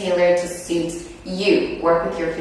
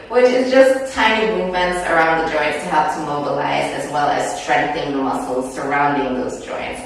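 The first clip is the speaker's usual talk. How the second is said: ordinary talk, nothing marked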